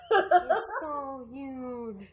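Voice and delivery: very slow, loud parody boast; hammed-up presidential catchphrase; Trump. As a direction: stretch "huge" out absurdly. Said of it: "that was my Trump impression".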